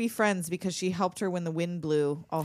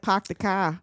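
put-on Boston accent; 2 semitones lower, slower than usual. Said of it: "I thought they were like".

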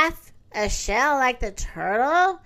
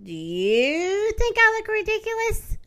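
a high, unsure hippo voice; the hippo timidly asks the nightingale; anxious hippo question; 8 semitones higher than usual. Acted. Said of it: "she asked the nightingale".

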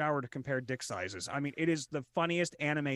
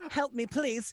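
whimpering plea; 7 semitones higher, slower than usual. Pretend. Echoing plea, laughing.

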